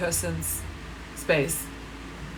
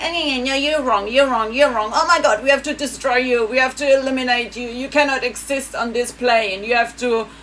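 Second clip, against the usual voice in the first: higher, louder ranting caricature; mocking angry German; 4 semitones higher, 5 dB louder than usual. Mocking Germans who shout her down, loud and ranting. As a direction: snap and rant like a furious zealot. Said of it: "one snaps and goes like".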